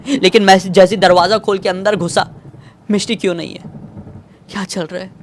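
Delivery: usual speaking voice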